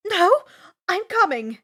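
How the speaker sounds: loud, insistent refusal; firm argument. A narrator's reading. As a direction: cut him off and insist. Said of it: "Annabeth argues".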